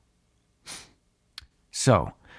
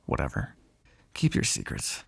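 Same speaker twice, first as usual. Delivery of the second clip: low, soft grumbled brush-off; dismissive; 14 semitones lower, 8 dB quieter than usual. Acted. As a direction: drop low and quiet, giving up on it. Gives up on getting an answer.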